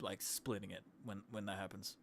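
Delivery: tired, fading voice; worn-out voice near the end; voice giving out. Real, not acted. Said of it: "I'm running out of voice here".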